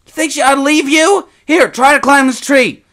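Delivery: put-on high, loud, urgent boy's cry; panicked shout. A narrator's reading. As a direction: yell it with a bear coming. Boy refuses to leave brother.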